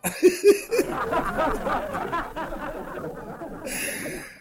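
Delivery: high, wheezing giggles; drawn-out high laughter; long laugh. Real, not acted. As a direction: laugh at yourself heartily. Laughs about own old age.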